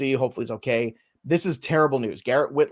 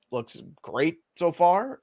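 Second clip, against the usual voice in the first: ordinary delivery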